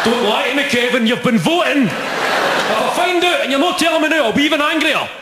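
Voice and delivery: high, loud furious dad yelling at his son; angry dad. Acted. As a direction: bellow it like a livid father catching a lie.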